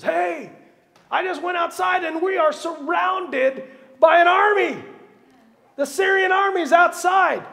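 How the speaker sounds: high, loud panicked shout; frantic high shout of alarm; panicked servant. Retold or imitated. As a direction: servant bursting in to raise the alarm. Panicked servant voiced in story.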